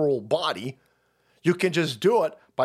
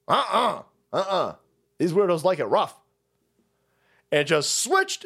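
ordinary delivery

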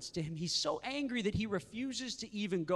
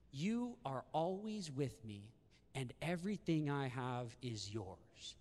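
tender father; 4 semitones lower, 6 dB quieter than usual. Acted. Repeating the father's loving words.

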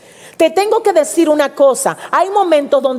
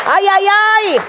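high, fervent cries; preacher caught up in the Spirit; ecstatic ay ay ay; 6 semitones higher, slower than usual. Real, not acted. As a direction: cry out in spiritual fervor.

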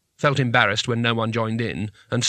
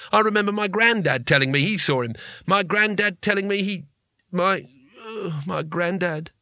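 faltering; 6 semitones higher than usual. Acted. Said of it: "He faltered under the gimlet gaze".

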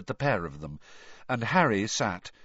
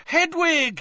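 high, excited call; shout; 5 dB louder than usual. A narrator's reading. Harry spotting his owl at the window, shouting. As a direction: cry out the name on sight. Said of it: "he shouted".

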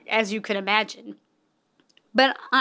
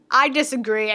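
ordinary talk, nothing marked

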